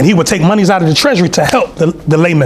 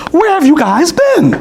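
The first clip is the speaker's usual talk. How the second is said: high, drawn-out mock British woman; slow, high, hammed-up British question; British accent; 12 semitones higher, slower than usual. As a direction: stretch it out in a pitched-up English accent. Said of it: "They had a British accent".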